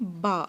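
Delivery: ba with lips pressed fully shut, slow; Arabic letter ba. Shown as a demonstration. Teacher models با for students, lips fully closed. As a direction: close both lips fully and release با slowly. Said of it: "اپنے ہونٹوں کو جوڑیں".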